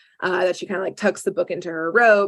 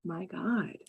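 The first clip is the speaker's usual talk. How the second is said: under the breath, much softer, fed up; exasperated mutter; 14 dB quieter, much slower than usual. Retold or imitated. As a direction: sigh it out like a worn-out adult. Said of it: "he's just like".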